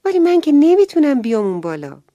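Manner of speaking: put-on a boy sure he can't fly, blurting it out; worried little boy. A narrator's reading. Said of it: "هومن گفت".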